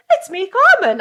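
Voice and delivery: high, loud, sing-out notification line; imitated enthusiastic example. Friend showing how the voice note should sound, hammed-up enthusiasm. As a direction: announce yourself with exaggerated enthusiasm. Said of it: "with a little bit more enthusiasm".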